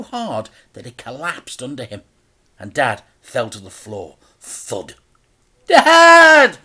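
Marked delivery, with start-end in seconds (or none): 5.7-6.6 much higher, loud frantic call; boy's cry. A narrator's reading. Frank shouts as Dad falls. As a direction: cry out for him. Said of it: "shouted Frank".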